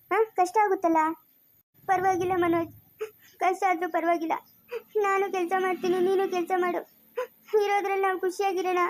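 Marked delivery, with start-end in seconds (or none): 1.9-8.8 crying but determined; weepy, pleading reassurance; tearful. Acted. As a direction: insist through sobs. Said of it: "ಯಾಕೆ ಅಳ್ತಿರೋದು ನೀನು".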